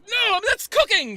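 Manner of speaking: very high, loud scolding shriek; outraged wife. Acted. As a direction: shriek at someone meddling with the food. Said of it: "she looks at you like".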